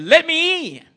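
high, loud wolf cry at the brick house; wolf crying out, a touch hammed. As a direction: call out like the wolf at the door. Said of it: "cried the wolf".

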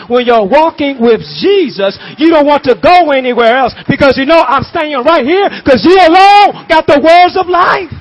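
high, loud whooping proclamation; preaching climax. Real, not acted. Preacher proclaiming Jesus alone has the words, shouting. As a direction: shout it to the rafters.

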